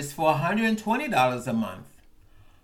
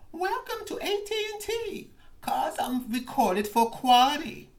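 automated phone voice; 8 semitones higher than usual. Retold or imitated. Recounting the call, mimicking the AT&T robot phone menu voice. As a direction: imitate a cheerful automated phone system.

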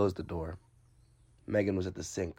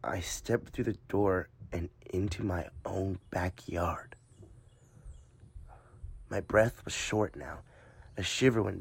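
slow hushed whisper; 15 dB quieter, slower than usual. A narrator's reading. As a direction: breathe the words out slowly. Hushed horror narration.

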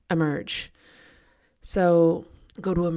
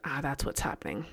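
quiet realization; 4 semitones lower, 9 dB quieter than usual. Retold or imitated. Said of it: "And I'm like".

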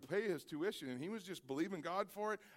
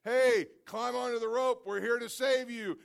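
loud, high call over a loudspeaker; 7 semitones higher, 9 dB louder than usual. Acted. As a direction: hail him from the helicopter. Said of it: "and a loudspeaker".

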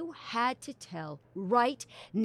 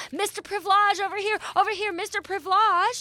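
higher, louder calls across the crowd; calling out; 11 semitones higher, 5 dB louder than usual. Acted. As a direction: flag him down over the noise. Reporter calls out to director.

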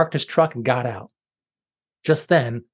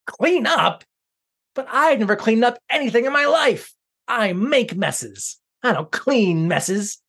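higher, slowing indignant whine; indignant villain; 8 semitones higher than usual. Acted. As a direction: refuse in disbelief. Villain refusing to clean.

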